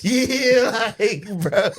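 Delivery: high, laughing agreement; real laughter breaking through the words. Real, not acted. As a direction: crack up while talking. Cracking up at own story.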